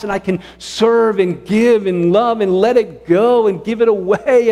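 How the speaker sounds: fervent sermon climax; preaching at full force. Real, not acted. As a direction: drive it home from the pulpit.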